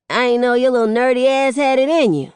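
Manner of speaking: high-pitched, loud playful ribbing; teasing. A narrator's reading. King Lord teases the nerdy boy, teasing. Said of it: "a King Lord teases".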